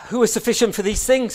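Paul's pleading question; voicing Paul. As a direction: let the apostle cry out his doubt. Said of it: "says Paul".

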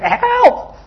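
high pitched cry of help; retold desperate yell for help; retold scream for help. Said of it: "I remember screaming to him".

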